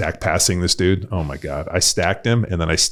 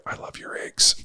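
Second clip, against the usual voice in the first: soft unvoiced whisper; hushed comic whisper; 6 dB quieter than usual. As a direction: whisper it like a secret punchline. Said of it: "And I whispered back".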